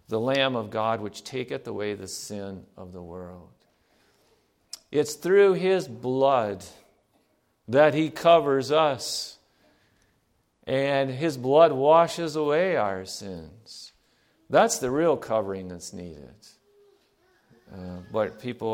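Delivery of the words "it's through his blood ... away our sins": slow emphatic. Acted. Preacher stressing the blood slowly.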